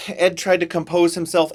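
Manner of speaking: usual speaking voice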